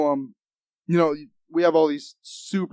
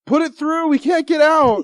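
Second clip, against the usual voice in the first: high, louder mocking yell; 10 semitones higher, 5 dB louder than usual. Mocking the stuck classmates, loud high yell. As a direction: ham up the trapped kids yelling.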